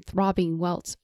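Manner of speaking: usual speaking voice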